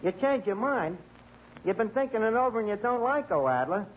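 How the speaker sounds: ordinary talk, nothing marked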